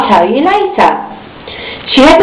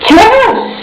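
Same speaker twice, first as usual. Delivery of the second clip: much higher, chirpy; little girl voice; 13 semitones higher than usual. A narrator's reading. Miss Star tells the taxi driver, high and chirpy. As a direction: answer like a cheerful little girl.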